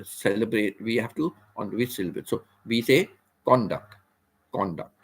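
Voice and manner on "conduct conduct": "conduct" as CON-duct; emphasis on CON. Shown as a demonstration. Teacher repeats the noun, "conduct" weighted on CON. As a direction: stress the first syllable of "conduct". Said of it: "we are more emphasizing on con".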